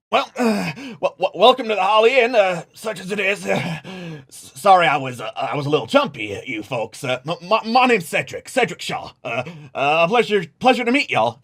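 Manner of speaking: stammering nervous innkeeper; a jumpy innkeeper stumbling over words; lightly voiced nervous barkeep; Cedric. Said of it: "goes behind the bar and says".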